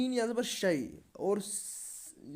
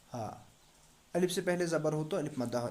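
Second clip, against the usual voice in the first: usual speaking voice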